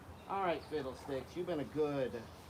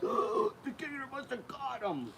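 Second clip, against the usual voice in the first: mock horrified passerby; 9 semitones higher than usual. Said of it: "would be like".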